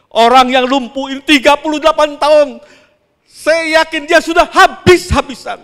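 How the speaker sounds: loud, high, impassioned preaching; preaching at full force. Acted. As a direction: drive the congregation to the climax, shouting. (berkhotbah dengan suara lantang dan tinggi)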